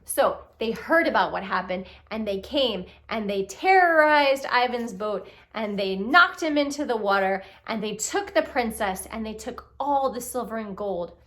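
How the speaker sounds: ordinary delivery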